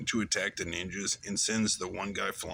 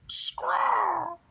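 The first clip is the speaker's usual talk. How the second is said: imitated bird cry, very high; bird screech; 3 dB louder than usual. Retelling how Scraw grabs Charlie, mimics its very high cry. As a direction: let out a piercing bird call. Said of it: "Scraw goes".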